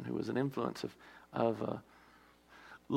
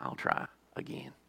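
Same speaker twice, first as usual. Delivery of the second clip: breathless unvoiced whisper; 9 dB quieter than usual. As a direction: drop to a bare whisper as God. God voiced in sermon story.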